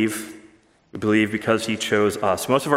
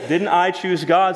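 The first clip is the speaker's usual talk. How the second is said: higher, mock-offended question; indignant; 7 semitones higher, 3 dB louder than usual. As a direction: play the indignant objector. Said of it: "somebody asks indignantly".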